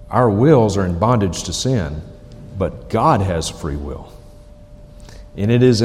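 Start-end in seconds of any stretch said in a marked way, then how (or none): none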